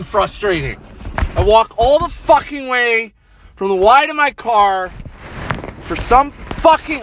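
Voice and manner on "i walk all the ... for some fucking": loud, higher, punchy ranting; real furious venting; angry rant. Real, not acted. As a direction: vent it all at once. Ranting about the long walk.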